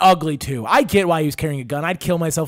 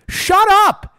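high, loud dismissal; mock outraged shout; 14 semitones higher, 7 dB louder than usual. Comedian dismissing gangbangers' music taste, high loud shout. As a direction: cut them off with a comic yell.

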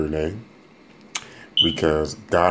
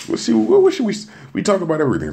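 hyped jump-in; 13 semitones higher, 4 dB louder, faster than usual. Real, not acted. Hyped topic start.

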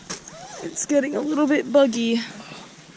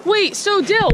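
calling out; 11 semitones higher than usual. Real, not acted. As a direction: shout after him across the woods. Calling after friend in woods.

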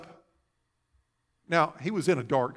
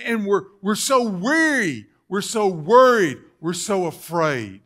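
loud, higher-pitched pulpit plea; full-force preaching; 9 semitones higher, 9 dB louder than usual. Acted. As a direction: cry it out to the congregation.

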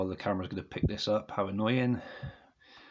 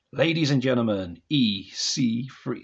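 mock ring announcer; 2 semitones higher, 4 dB louder than usual. Vlogger jokingly introduces the next one, mock announcer style.

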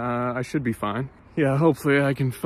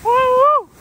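excited woo; 11 dB louder than usual. Real, not acted. First sled down the big hill.

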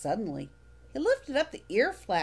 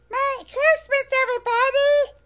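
high, loud furry monster call to friends; 11 dB louder than usual. Acted. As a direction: shout the greeting down the street. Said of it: "Elmo shouted".

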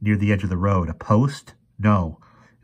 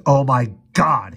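slow, loud, raised-pitch gasp; 6 semitones higher, 6 dB louder, slower than usual. A narrator's reading. Professor recognizes the creature, gasping. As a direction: gasp it in horrified shock. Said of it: "he gasped".

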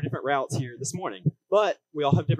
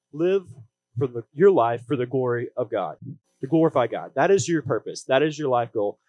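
upbeat youth pastor; 5 dB louder than usual. Acted. Preaching with growing force.

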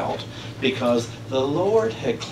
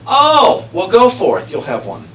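mock dismissive priest; 7 semitones higher, 7 dB louder than usual. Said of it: "Eli's answer voiced".

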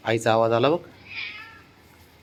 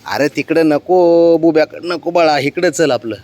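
drawn-out, louder pet talk; slow coaxing; 4 semitones higher, 6 dB louder, slower than usual. Real, not acted. Pulling her pet away from the other animal, slow coaxing. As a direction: coax the little one away, slowly and loudly.

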